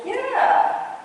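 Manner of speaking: very high, sing-song answer; sweet reply. Retold or imitated. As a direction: answer in a high, playful pitch. Her sweet reply, retold.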